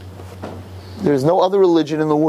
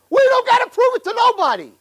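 fiery preaching. Real, not acted. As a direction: thunder it at the congregation. Preacher's climax declaration.

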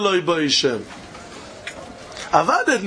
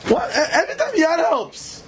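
laughing; 7 semitones higher than usual. Real, not acted. Laughing at his own question.

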